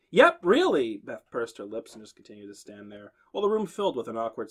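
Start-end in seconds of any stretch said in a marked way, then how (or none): 0.1-1.0 cheery insistence. A narrator's reading. RA doubling down.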